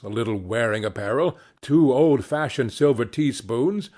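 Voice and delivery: ordinary delivery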